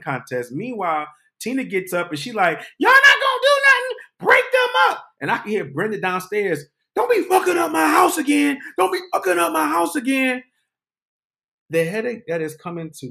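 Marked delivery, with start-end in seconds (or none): 2.8-5.1 high-pitched, loud imitation of a woman yelling; shouted impression of an alarmed woman; acted-out yelling. Tina orders onlookers to intervene. 6.9-10.4 high, loud imitation of a woman shouting from downstairs; retold scolding yell; acted-out angry shout.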